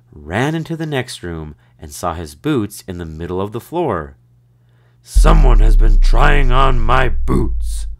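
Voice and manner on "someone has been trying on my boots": booming, loud and slow; big bear voice. A narrator's reading. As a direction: boom like a huge bear. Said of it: "he boomed in his big bear voice".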